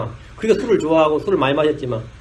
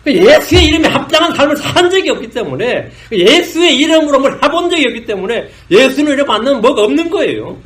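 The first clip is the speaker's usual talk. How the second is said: higher, louder impassioned sermon; full-force preaching; 5 semitones higher, 4 dB louder than usual. Acted. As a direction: drive the point home to the congregation. Preaching climax. (힘차게 외치는 설교)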